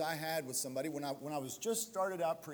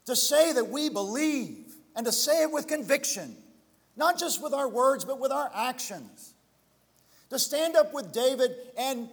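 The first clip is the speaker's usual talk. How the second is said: loud, higher, rousing preacher's call; rally the congregation to stand up; loud pulpit exhortation; full-force preaching; 8 semitones higher, 10 dB louder than usual. Acted.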